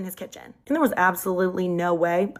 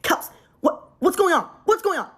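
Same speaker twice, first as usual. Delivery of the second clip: worried caller; 9 semitones higher than usual. Retold or imitated. Boyfriend on the butt-dialed call, anxiously calling out. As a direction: call out into the phone, getting frantic.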